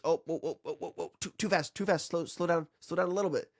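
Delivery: high, stammering mock panic; alarmed compressor. Compressor overshooting its target, acted high-pitched alarm. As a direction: pitch it up and repeat in flustered bursts. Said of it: "it says".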